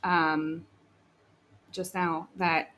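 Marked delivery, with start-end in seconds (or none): none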